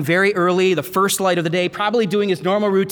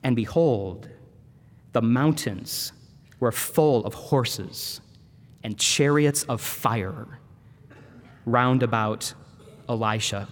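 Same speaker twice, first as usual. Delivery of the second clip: soft, slow, awed storytelling; hushed sermon narration; 8 semitones lower, 5 dB quieter, slower than usual. Acted. Preacher revealing the heavenly army, softly and slowly. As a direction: let the vision unfold in a hush.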